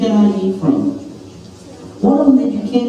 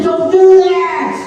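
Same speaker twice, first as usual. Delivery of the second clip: much higher, louder, slow cry; God's warning cry; 10 semitones higher, 3 dB louder, slower than usual. Acted. God crying out to Eve not to eat, loud. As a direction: call out to stop her.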